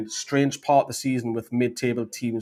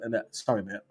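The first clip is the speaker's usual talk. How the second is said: normal talking voice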